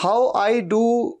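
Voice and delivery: very slow, drawn-out learner English; beginner's attempt. Teacher recalling how he began speaking English, slow and halting. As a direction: piece it together haltingly. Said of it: "तो ऐसे ही शुरुआत की थी".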